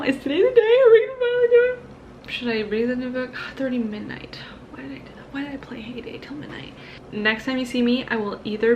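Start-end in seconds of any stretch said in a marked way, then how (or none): 0.0-1.8 high, excited and eager. Real, not acted. Hyped about starting new book. 4.7-6.7 hushed regretful whisper. Real, not acted. Regretting late gaming.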